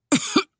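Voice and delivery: a staged, pointed throat-clearing cough; theatrical little choke; exaggerated cough. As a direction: fake a cough to get her attention. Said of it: "Christopher's exaggerated cough".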